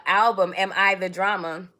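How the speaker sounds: ordinary talk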